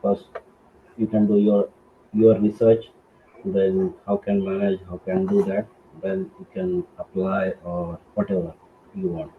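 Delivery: normal talking voice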